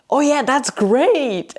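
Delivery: high, drawn-out mock enthusiasm; overdone "so great" cheer; amused fake congratulations. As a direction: gush slowly as if delighted, holding back laughter. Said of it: "And I started laughing".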